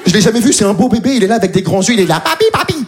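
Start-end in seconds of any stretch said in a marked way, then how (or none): 2.2-2.8 put-on high babbling toddler voice; baby voice. Comedian voicing the baby calling him, high.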